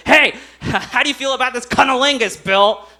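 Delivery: loud mocking taunt. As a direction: throw it at him loudly. Wife taunting Bill.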